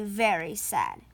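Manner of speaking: ordinary delivery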